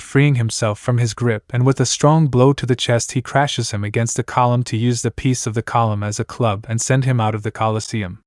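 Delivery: ordinary delivery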